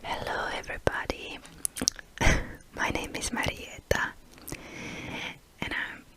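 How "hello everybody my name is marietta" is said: normal talking voice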